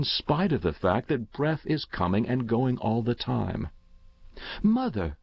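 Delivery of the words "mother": high, falling call to mother; a hint of a child's voice, pitched up; lightly voiced young boy. A narrator's reading. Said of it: "said the boy, Paul".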